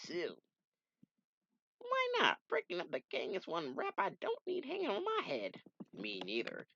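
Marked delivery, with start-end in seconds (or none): 1.8-5.5 very high, cocky voice; squeaky tough guy; high character voice. Acted. Comic character voiced by reader.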